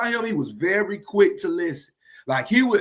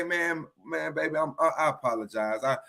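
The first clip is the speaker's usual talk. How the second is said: low, soft, contrite murmur; subdued, apologetic, much lower voice; sheepish apology; 7 semitones lower, 5 dB quieter than usual. Acted. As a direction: own up quietly to a partner after an outburst. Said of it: "now you gotta go back and say".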